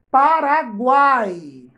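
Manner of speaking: Paraguai said slowly and loudly, syllable by syllable; slow word demo. Shown as a demonstration. Teacher drawing out a word for pupils, slow and loud. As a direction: stretch out Paraguai for the class. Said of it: "Ó, o ai".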